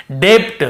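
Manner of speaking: डेप्ट with the b sounded before t, like "dept"; wrong pronunciation. Shown as a demonstration. Teacher showing the reading without the rule, डेप्ट with b sounded. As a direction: put a stop of the lips before the t in डेप्ट. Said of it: "ये नहीं होता तो हम कैसे पढ़ते?".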